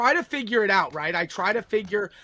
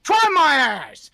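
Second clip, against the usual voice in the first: louder, slow defiant line; slow angry jab; 5 semitones higher, 8 dB louder, slower than usual. Real, not acted. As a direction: dare him, slow and loud. Angry rant at streamer.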